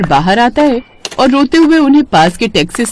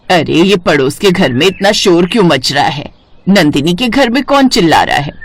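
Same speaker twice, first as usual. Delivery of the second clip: put-on lower, older mother-in-law voice, sweetly fond; 3 semitones lower than usual. Mother-in-law fussing over the neighbour's halwa, lower voice. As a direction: play the doting mother-in-law, a lower aged voice.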